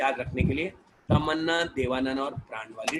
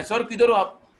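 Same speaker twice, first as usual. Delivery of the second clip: higher and louder eager caller; calling on the phone; 6 semitones higher, 7 dB louder than usual. Retold or imitated. Students phone the teacher from McDonald's, loud and eager. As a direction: call out over the phone line.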